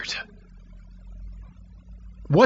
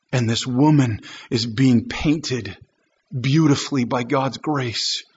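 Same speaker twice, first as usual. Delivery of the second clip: ordinary talk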